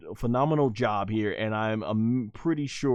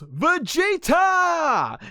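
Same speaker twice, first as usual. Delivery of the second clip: high, drawn-out imitation of a woman yelling; acted yell; much slower than usual. Bluma calling out to Vegeta, acted yell. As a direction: stretch the name in a piercing mock scream. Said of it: "And she yells".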